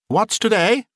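high-pitched eager call. A narrator's reading. Scrooge calls downward to a boy, eager. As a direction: call out a question from above. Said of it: "cried Scrooge, calling downward to a boy".

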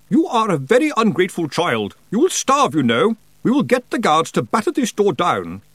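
high, loud, peevish threats; scolding aunt. Acted. Aunt threatens to break down Roland's door, high angry scolding.

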